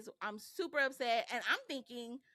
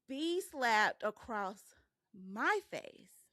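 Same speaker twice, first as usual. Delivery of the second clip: normal talking voice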